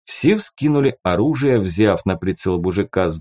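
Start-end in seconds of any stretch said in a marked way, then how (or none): none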